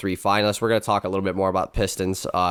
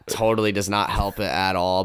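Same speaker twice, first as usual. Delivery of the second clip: ordinary talk, nothing marked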